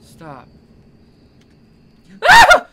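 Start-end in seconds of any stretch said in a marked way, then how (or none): 2.3-2.7 very high, very loud shriek of real fright; terrified scream. Real, not acted. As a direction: scream at the jump scare. Jump scare in game.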